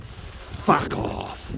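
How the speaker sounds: mock old man in an unvoiced rasp; imitating old guy. Vlogger retelling the old couple confrontation, imitating old guy. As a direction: softly mimic an old man's dismissal. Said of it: "The old guy's like".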